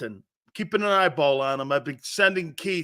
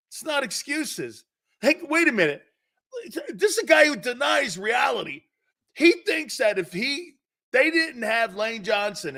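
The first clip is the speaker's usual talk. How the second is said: raised, higher voice, jabbing at his co-host; ranting at Tone over the Niners, raised and high; heated outburst; 8 semitones higher than usual. Real, not acted. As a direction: shout him down, voice up high.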